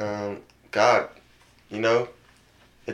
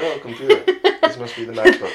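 mock surprised voice; 13 semitones higher, 9 dB louder than usual. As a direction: gasp at it in a put-on voice. Mock discovery of laptop.

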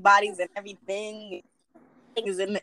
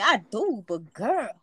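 ordinary delivery

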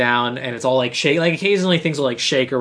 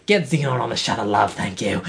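Chelsea's handler giving orders, lightly imitated lower voice; 6 semitones lower than usual. Handler orders Xenon onto the shuttle, lightly imitated low voice. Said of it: "whoever the, his handler is".

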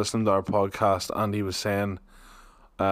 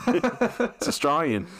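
mock Australian; 7 semitones higher, much slower than usual. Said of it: "thanks for clearing that one up".

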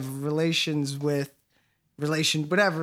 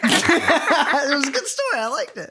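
high-pitched, laughing through the words; amused, laughing praise; 9 semitones higher, 4 dB louder than usual. Real, not acted. Laughing after teasing the storyteller.

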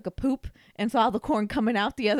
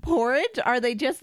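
ordinary delivery

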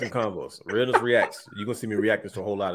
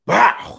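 mock shout; 12 semitones higher, 9 dB louder than usual. Imitating wrestler's tackle shout.

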